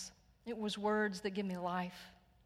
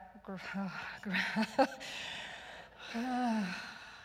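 long embarrassed giggle. Real, not acted. Laughs at her own stumble.

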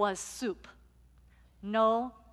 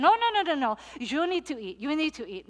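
insistent high protest; 4 semitones higher, faster than usual. Retold or imitated. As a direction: brush the excuse aside. Luisa refuses again.

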